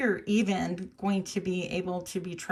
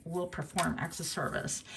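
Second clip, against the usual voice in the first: mocking repeat; 2 semitones lower, 7 dB quieter than usual. Shown as a demonstration. Reader quietly echoing her own theatrical phrase. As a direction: mutter it back to yourself, amused. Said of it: "so theatrical".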